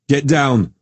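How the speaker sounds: urgent pleading call; begging them to get down; desperate plea. Acted. Soldier pleading with the newcomers, desperate. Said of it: "Parrish pleaded desperately".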